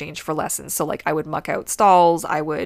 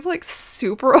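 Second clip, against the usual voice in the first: normal talking voice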